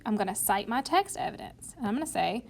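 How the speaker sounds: ordinary talk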